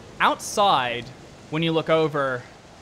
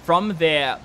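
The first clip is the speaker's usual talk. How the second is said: usual speaking voice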